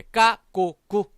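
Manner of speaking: 까, 꼬, 꾸 with tight, unaspirated k; tense k. Shown as a demonstration. Teacher modelling C before a, o, u, tense k. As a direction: hit 까, 꼬, 꾸 hard, one by one. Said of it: "쌍기역 발음이 날 거예요".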